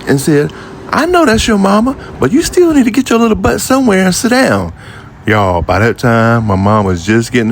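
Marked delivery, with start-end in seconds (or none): 0.9-4.7 much higher, stern salon lady; lightly voiced lady scolding a boy. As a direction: tell off a fidgety kid from under the dryer.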